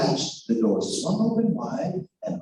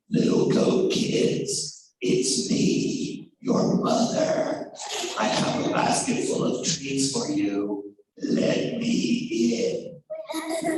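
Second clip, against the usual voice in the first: wolf faking mother; 9 semitones higher, much slower than usual. Acted.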